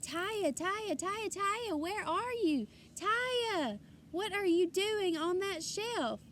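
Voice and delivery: put-on high, carrying call for a child. A narrator's reading. Father searches the aisles for his daughter, calling. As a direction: call across the store for her.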